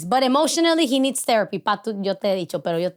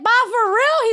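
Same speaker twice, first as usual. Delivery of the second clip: high, loud, pitched-up disbelief; surprised disbelief; 11 semitones higher, 5 dB louder, slower than usual. Retold or imitated. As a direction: squeal it like you can't believe he'd say yes. Daughter surprised at dad's offer.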